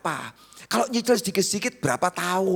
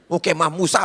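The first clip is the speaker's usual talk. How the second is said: ordinary talk, nothing marked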